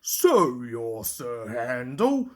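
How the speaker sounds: low, slow offhand drawl; casual. A narrator's reading. George the steamroller meeting Sir Handel, in a low casual drawl. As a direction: size him up without caring. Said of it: "George said casually".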